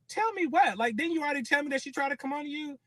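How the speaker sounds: retold demand to the boyfriend; alarmed demand. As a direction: demand an answer right now.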